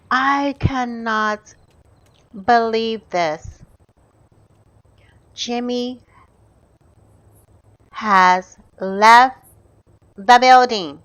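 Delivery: slow, halting, with long pauses; word by word, building to loud; dramatic disbelief. Real, not acted. Gamer announces Jimmy has left, dramatic disbelief.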